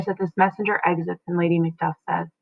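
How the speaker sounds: ordinary delivery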